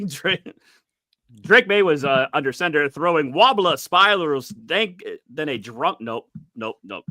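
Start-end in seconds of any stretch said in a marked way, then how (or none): 1.4-6.0 halting, badly faked Scottish read; Scottish accent that keeps slipping. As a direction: stumble through a script in a shaky attempt at Scottish. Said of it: "I can't do Scottish".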